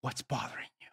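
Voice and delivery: hushed, breathy question; a whispered pastoral question; soft whisper. Retold or imitated. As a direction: whisper it gently to a troubled man. Professor asks quietly, retold.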